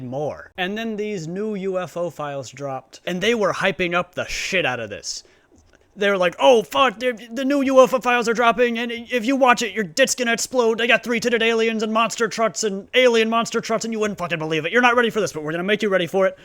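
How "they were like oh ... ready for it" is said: loud, high hype-man ranting; mock hype. Mocking the hype crowd in a loud, high hype-man rant. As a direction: ham it up like a breathless hype trailer. Hype-men announcing the UFO files.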